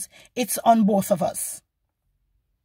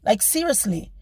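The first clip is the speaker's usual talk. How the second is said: ordinary talk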